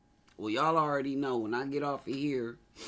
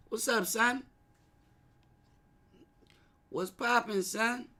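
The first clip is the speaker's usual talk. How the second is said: Brooklyn greeting; 10 semitones higher than usual. Retold or imitated.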